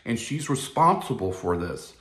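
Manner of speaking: usual speaking voice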